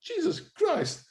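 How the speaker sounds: high, slow, drawn-out gasp of disbelief; mock-stunned "Jesus Christ" at the sight of his photo; stunned exclamation. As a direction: let it rise way up in shock, slowly. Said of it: "I went".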